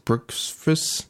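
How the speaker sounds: puzzled question. Real, not acted. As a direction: quietly ask "Brooksvis?" high and rising. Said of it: "B R X V S".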